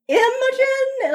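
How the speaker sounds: Imogen with a high, drawn-out IM; stress on first syllable. Retold or imitated. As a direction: lean hard on the start of Imogen, questioning. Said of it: "accent on, on the im".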